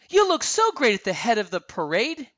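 high-pitched, eager gushing; bubbly compliment in a high voice; excited praise. A narrator's reading. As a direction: gush with delight. Anna claps, praising her sister.

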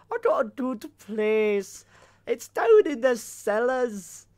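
put-on slow Scottish accent, a bit hammy; drawn-out attempt at a Scottish accent. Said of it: "So this is me trying to do a Scottish accent".

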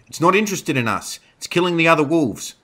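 loud, high, urgent shouting; screamed. A narrator's reading. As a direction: shout an urgent realisation over the fight. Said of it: "Dana screamed".

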